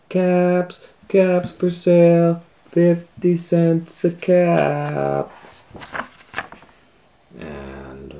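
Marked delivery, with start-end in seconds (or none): 0.1-5.3 high, loud, slow sing-song street cry; peddler calling. Acted. Peddler calling his caps down the street, slow sing-song cry. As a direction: call out wares down the street, drawn out. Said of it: "he kept calling".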